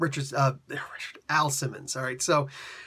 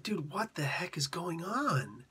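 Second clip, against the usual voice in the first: slow, softer unvoiced whisper; 3 semitones higher, 6 dB quieter, slower than usual. Kid in the creepy toy store whispering nervously. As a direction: hush it like a spooked kid.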